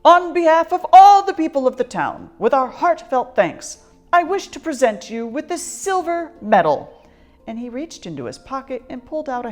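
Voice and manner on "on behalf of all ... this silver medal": put-on mayor addressing the old lady with ceremony; pompous mayor. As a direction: proclaim it like a grand town mayor. Mayor makes a speech.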